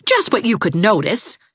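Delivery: stinging reply; biting young woman. A narrator's reading.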